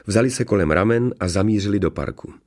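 plain reading voice

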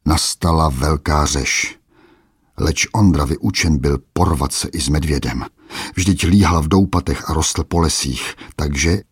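plain reading voice

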